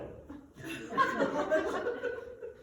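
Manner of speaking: very high breathy rising cackle; witch cackle. Acted. Charwoman gloating to Joe, high witch cackle.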